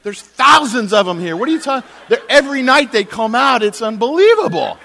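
high, very loud exasperated yelling; really mad. Retold or imitated. Tenant to landlord about monkeys, really mad. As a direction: explode in acted outrage at being doubted. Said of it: "Now I'm really mad".

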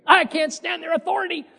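put-on sulky kid fed up with parents; lightly mimicked rebellious teen; whiny teenager. Mimics complaining young person.

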